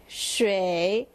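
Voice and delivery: "shui" dipping low, then rising; third tone. Shown as a demonstration. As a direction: draw "shui" down and back up. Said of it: "so down and up".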